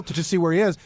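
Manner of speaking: usual speaking voice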